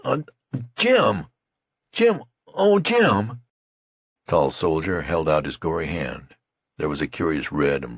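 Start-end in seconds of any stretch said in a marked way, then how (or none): 0.0-3.4 high, loud broken cries of Jim; stammering anguish. A narrator's reading. Youth stammers in horror at his wounded friend. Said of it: "He stuttered and stammered".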